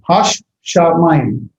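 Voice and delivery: "Hash Shamayim" slow and split between the two sh sounds; two shins. Shown as a demonstration. Teacher reading the transliteration, doubled sh in slow "Hash Shamayim". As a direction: slowly pronounce both sh's in "Hash Shamayim". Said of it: "it has two shins".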